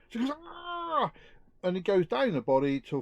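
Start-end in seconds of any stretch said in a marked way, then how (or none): 0.4-1.1 high, held comic cry of pain; hammed-up yelp of agony; mock pain cry. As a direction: cry out at the touch. Joke patient touches chest.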